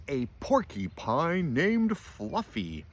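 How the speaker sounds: high, loud, drawn-out howl of amusement; howling in disbelief. A narrator's reading. Rhinoceros mocking Fluffy's name, howling. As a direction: howl each word slowly, as if it's absurd. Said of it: "howled the rhinoceros".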